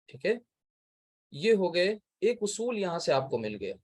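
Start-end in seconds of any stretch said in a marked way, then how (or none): none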